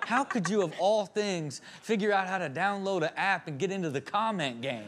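ordinary talk

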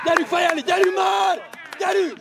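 loud, high rallying yell; urging shout. Real, not acted. Spectator urging players to head the ball, shouting. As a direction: drive the team on at full voice.

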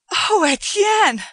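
high, loud, slow delighted cry; young woman thrilled at the sight; ringing with delight. A narrator's reading. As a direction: cry out his name in joy. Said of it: "in a voice ringing with delight".

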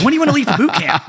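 high, quick, eager pitch; eager sergeant. Retold or imitated. Marine recruiter seizing a new recruit, mock eagerness. Sergeant suddenly keen to enlist him.

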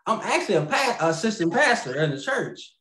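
usual speaking voice

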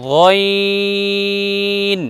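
গইন held long from the throat; Arabic letter demo. Shown as a demonstration. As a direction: draw out গইন. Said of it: "দ্বিতীয়টা উচ্চারণ করব". (গইন টেনে উচ্চারণ)

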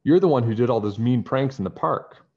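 loud accusation; accusing. Acted. Nancy accusing Bradley of the pranks, loud and firm. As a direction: nail the culprit out loud.

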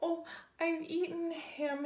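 high, lightly wailed lament; a pretend tearful cry; mock crying dog. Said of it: "he cried".

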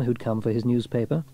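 ordinary delivery, nothing marked

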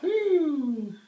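loud, high whoop; excited whoop. Real, not acted. Groggy patient cheering at himself.

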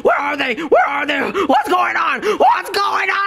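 high, loud frantic questions; panicked shouting. Acted. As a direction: call out frantically for friends. Luigi panics, left all alone.

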